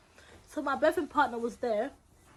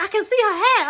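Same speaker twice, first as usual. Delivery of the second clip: excited; 6 semitones higher, 9 dB louder than usual. Retold or imitated. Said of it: "my boyfriend was like".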